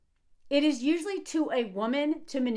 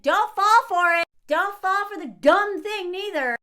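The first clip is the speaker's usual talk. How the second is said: loud, raised-pitch warning; emphatic warning; 5 semitones higher, 6 dB louder than usual. Real, not acted. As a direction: warn them forcefully, higher and louder. Urgent warning to women viewers.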